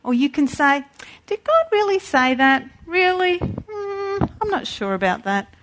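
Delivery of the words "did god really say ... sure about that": high, sceptical questioning; a doubter questioning God, sceptical; doubtful voice. Acted. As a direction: sound unsure, drawing out doubt. Voicing the doubter.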